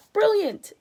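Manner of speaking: spy cheers the president's guess; excited cry. Acted. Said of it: "cried the chief spy".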